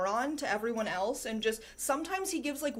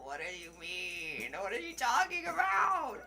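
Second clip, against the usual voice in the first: mock bewildered; 6 semitones higher than usual. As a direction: ham up total bafflement. Mock confusion at the message.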